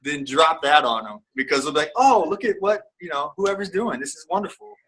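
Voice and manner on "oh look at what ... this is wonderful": higher-pitched gushing onlookers; voiced admiring reaction; mock admiration. Acting out admiring listeners.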